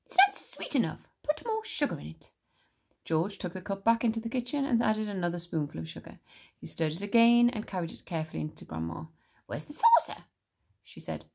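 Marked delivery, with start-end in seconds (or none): 0.1-2.3 put-on much higher, whiny old hag; grouchy grandma. Grandma complains about tea. 9.5-10.2 put-on much higher, sharp old crone demand; demanding grandma.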